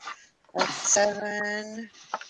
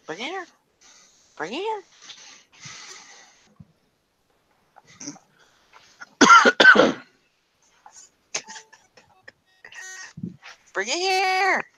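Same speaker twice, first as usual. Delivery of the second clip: coaxing pet voice; 12 semitones higher, 4 dB louder, faster than usual. Real, not acted. Coaxing the dog to bring toy.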